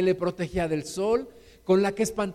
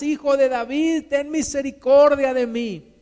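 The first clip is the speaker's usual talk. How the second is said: louder, higher insistent plea; crying out harder; 4 semitones higher, 6 dB louder than usual. Acted. Blind beggar refusing to be hushed, crying out harder. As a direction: shout the plea past those silencing you. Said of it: "pero él clamaba mucho más".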